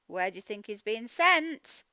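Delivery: ordinary talk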